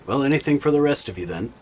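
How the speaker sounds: loud, fast tavern barkeep; gruff barkeep. Acted. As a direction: bellow it like a busy barman. Barkeep voice again.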